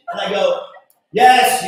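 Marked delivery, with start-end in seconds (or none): 1.1-1.6 loud, higher exasperated yes; loud giving-in. Retold or imitated. Boyfriend caught by his girlfriend, loudly giving in. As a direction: burst out with a surrender.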